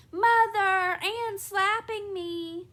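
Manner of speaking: high, loud tearful wail; girl cries to her mother after a slap; crying child. Acted.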